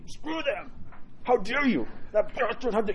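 high, squeezed indignant sputter; mock riled-up outrage. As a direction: act out wounded pride in a squeaky pitch. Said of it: "And we get riled up".

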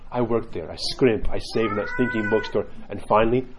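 low deadpan monk, slowing for the punchline; serious monk. Retold or imitated. Monk telling the convert of his savings, mock-serious. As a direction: imitate solemn testimony, savouring the last words slowly. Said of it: "very, like, serious".

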